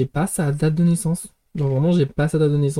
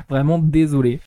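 normal talking voice

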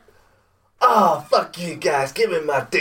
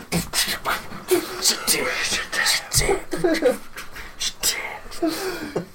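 whispered beatbox; 13 semitones higher, 3 dB quieter than usual. Acted. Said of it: "It's the remix".

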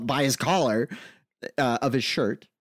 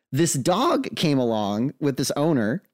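ordinary talk

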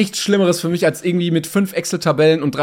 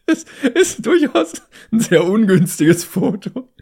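laughing; 7 semitones higher, slower than usual. Real, not acted. Laughing about the dog photo.

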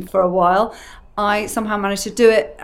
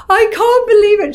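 high, loud gasp of disbelief; disbelieving joy; 13 semitones higher, 9 dB louder than usual. Retold or imitated. To the Chanel caller, a high loud squeal of disbelief. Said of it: "I was just like".

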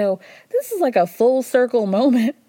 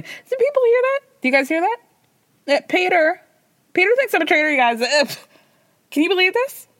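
mock indignant; 10 semitones higher, faster than usual. Mock outrage at Peter.

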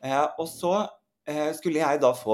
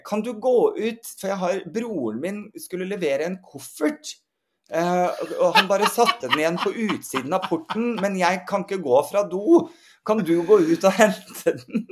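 higher, louder call through a door; 7 semitones higher, 6 dB louder than usual. Retold or imitated. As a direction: shout it from the bathroom, pleading. Friend calling out from toilet.

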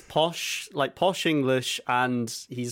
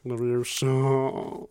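slow, drawn-out spell; quoting a video; much slower than usual. Retold or imitated. Fan quoting a parody video, slow drawn-out spell. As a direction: stretch the spell out slowly. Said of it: "I can quote those two videos".

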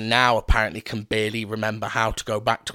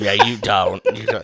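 laughing through the words, a bit louder; 2 semitones lower, 5 dB louder than usual. Real, not acted. Friend laughs, telling her she doesn't sound South African. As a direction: chuckle it out in disbelief.

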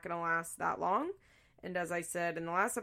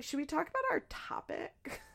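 higher, softer, prim little ask; mock prim question; 11 semitones higher, 7 dB quieter than usual. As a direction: sweetly pretend to steer back on topic. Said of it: "I'm like".

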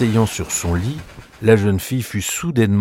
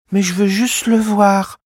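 put-on much higher small boy pleading; little boy voice; 12 semitones higher than usual. Fritz begs his sister to see the toy, high. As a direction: play a young boy asking. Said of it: "répliqua le garçon".

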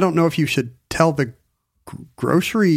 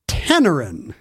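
normal talking voice

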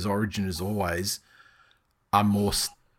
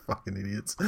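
quiet, under the breath; muttered; 3 semitones lower, 11 dB quieter than usual. Real, not acted. Muttered insult.

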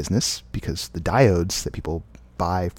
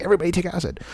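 higher, excited quoted exclamation; exclaiming, imitating Leary; 9 semitones higher, much faster than usual. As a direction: call it out like a giddy guru. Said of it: "he's like".

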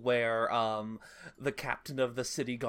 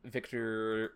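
ordinary talk

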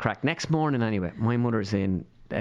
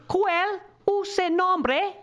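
very slow, high repeated question; imitating Spanish-speaking mother; much slower than usual. Perla's mother repeats her question to his baffled mother, imitated high and slow. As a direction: stretch each word for a baffled listener.

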